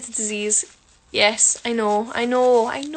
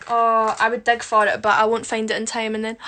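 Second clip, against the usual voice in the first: ordinary delivery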